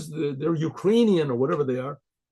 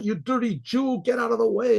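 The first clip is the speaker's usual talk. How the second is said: mild mock yell; 6 semitones higher than usual. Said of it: "the driver yells out".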